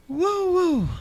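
high joyful woo woo; real excited whoop. Real, not acted. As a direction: cry out in delight. Joyful exclamation.

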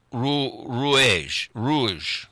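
fumbling towards the French word, retrying it; hesitant amateur French accent; attempted French pronunciation. Shown as a demonstration. Host struggling with the French name, halting French accent. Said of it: "I don't say it very well".

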